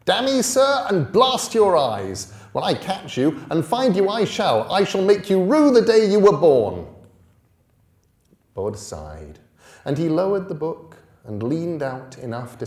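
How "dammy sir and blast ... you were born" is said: loud, furious threat, pitched high; angry shouting. Acted. Bully boy yelling threats up the path. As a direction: rage at him, promising revenge.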